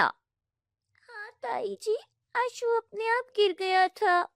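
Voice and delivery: put-on timid little girl speaking up; small girl. Young girl defending herself to her aunt, high and timid.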